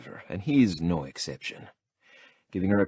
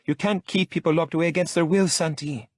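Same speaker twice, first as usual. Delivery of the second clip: much higher, louder indignant woman; Lola protesting; 11 semitones higher, 4 dB louder than usual. A narrator's reading. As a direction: protest with outrage. Lola's protest remembered.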